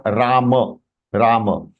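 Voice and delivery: "Ram" released into a short "muh"; full final ma. Shown as a demonstration. Teacher showing how it is pronounced, "Ram" as "Raa-muh". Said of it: "when we are pronouncing this word".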